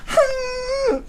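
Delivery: put-on high, whimpering hum like a crying little girl. Girlfriend crying over her ruined drawing, high whimpering hum. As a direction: whine through closed lips, close to tears. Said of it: "She sounded like that".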